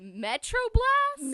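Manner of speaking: high, drawn-out, shouted disbelief; incredulous, slow and very loud; loud incredulity. Real, not acted. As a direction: repeat it back in outraged disbelief. Mocking the friend's unknown word.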